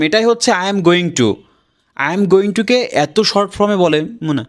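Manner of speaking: usual speaking voice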